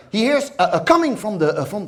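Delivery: ordinary talk, nothing marked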